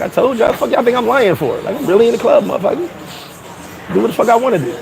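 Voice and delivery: acted defiant boast. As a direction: throw it back at the doubters. Boasting back at doubters.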